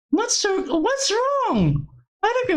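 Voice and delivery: high, panicked question; frantic. Acted. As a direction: ask in alarm.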